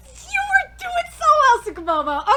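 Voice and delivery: high, loud teary reproach; disappointed wail. Real, not acted. Fan scolding the anime, teary disappointed wail. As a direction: wail at a letdown.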